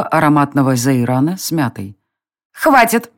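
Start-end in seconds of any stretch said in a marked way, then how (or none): 2.5-3.1 high fairy voice giving an order; commanding fairy. A narrator's reading. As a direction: snap a sharp command, high. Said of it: "скомандовала Тинь".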